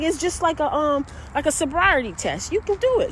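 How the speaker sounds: high, eager coaxing; encouraging. Retold or imitated. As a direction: cheer him through the balance. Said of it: "he's like".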